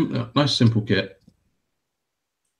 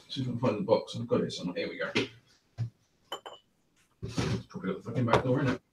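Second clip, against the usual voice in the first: muttering to himself; 10 dB quieter than usual. Real, not acted. Rummaging for a kit box.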